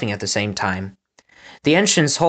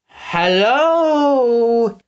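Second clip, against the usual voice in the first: high, stretched, goofy hello; overdone greeting; 10 semitones higher, 3 dB louder than usual. Acted. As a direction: greet way too eagerly. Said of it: "opening his eyes so wide".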